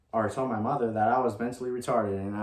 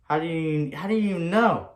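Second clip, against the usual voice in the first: shocked demand; 8 semitones higher, 6 dB louder than usual. Retold or imitated. Accusing him of spying.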